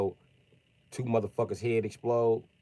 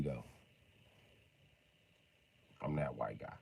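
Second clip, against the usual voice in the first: ordinary talk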